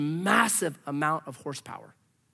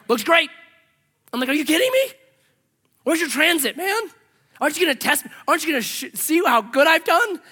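higher and louder, a mock outraged protest; indignant disbelief; 10 semitones higher, 7 dB louder than usual. Retelling arguing with the septic inspector, mock outraged. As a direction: act out the stunned frustration at the inspector.

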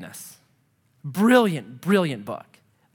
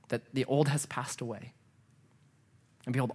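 soft, lowered, solemn preaching voice; tender reassurance; 5 semitones lower, 9 dB quieter than usual. Real, not acted. Preacher invites congregation to the table, tender and reassuring. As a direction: gently reassure them, hushed and low.

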